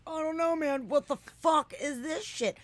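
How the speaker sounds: high, loud panicked yell; retold panicked yell at a glowing object; freaked-out shout. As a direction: yell in stoned terror.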